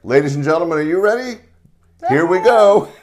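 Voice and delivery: big, rising showman announcement; announcer intro. Acted. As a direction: hype the crowd like an emcee. Host introducing a comedian.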